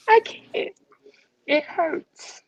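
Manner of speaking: holding back tears, barely getting it out; choked up. Real, not acted. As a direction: voice breaking high and strained on each short phrase. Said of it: "I'm getting emotional".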